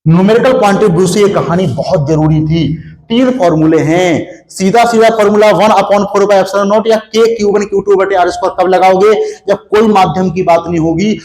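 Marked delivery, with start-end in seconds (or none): none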